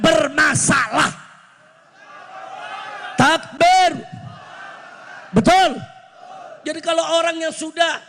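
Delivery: high, forceful preaching with punched phrase endings; fiery rally oratory. Real, not acted. Preacher demanding a clean cabinet from the president, fiery oratory. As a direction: hammer out each demand to a big crowd.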